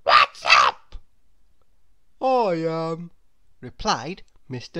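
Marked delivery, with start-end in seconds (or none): 0.0-1.0 loud, tiny character voice; Mister Small. A narrator's reading. Mister Small questions gloomy Mister Tall, tiny voice. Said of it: "said Mister Small". 2.2-3.1 lightly voiced, doleful character; glum tall man. A narrator's reading. Mister Tall answers Mister Small, mildly gloomy.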